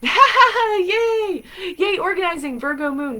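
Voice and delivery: high, loud laughing yay; giddy laughing cheer for April. Real, not acted. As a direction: cheer her on through a laugh.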